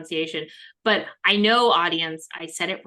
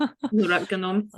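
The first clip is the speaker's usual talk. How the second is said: slow echo in mock-Russian accent; playful Russian-accented echo; slight Russian accent; much slower than usual. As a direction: copy the light Russian accent, drawn out. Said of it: "slight Ru-, Russian accents".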